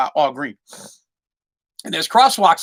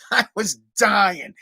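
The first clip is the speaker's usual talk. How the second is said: ordinary delivery, nothing marked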